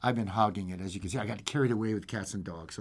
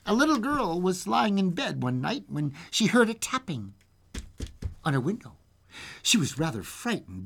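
lively storyteller with swooping pitch; storybook reading, animated; fairy-tale narration; 4 semitones higher than usual. A narrator's reading. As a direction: tell a bedtime tale with suspense. Reads fairy tale aloud.

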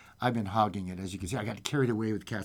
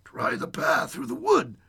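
high, odd little creature voice; high-pitched character voice; talking stone; 12 semitones higher than usual. Acted. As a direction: speak as a small enchanted stone. Talking stone gives advice.

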